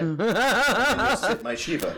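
laughing. Real, not acted. Joking through laughter.

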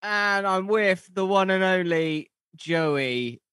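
high, stretched-out announcer intro; hammed-up show announcer. Host introducing the show and co-host, hammed-up announcer. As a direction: sing out the welcome, drawing out each name.

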